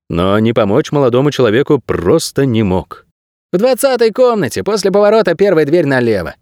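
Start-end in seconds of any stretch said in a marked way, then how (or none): 3.5-6.3 high-pitched servant character voice; obliging servant. A narrator's reading. Corridor servant directing a stranger to a room, obliging. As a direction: give directions in a high, put-on voice, easing down at the end.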